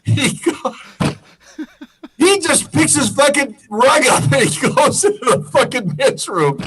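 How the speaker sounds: normal talking voice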